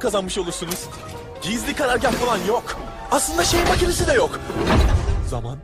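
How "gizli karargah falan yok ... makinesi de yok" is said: angry outburst. Acted. Arguing, denying the secret base.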